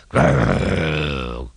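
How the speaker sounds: low sulky growl; deep mock engine growl. A narrator's reading. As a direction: growl and slink off. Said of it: "growled Diesel".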